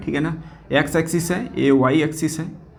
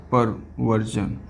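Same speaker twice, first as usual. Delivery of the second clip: very slow, softer, lower delivery; slow dictation; 7 semitones lower, 5 dB quieter, much slower than usual. Real, not acted. Teacher repeating the new term for students, slow dictation. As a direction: draw out perversion slowly and quietly.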